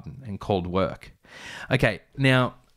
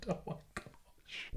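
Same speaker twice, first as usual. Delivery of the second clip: whisper; 6 semitones higher, 21 dB quieter, slower than usual. Real, not acted. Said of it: "unvoiced aside".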